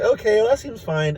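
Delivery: high, accepting Morty voice; Morty impression. Morty accepting Rick's plan in high Morty voice. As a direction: go along with it like Morty. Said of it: "Morty's like".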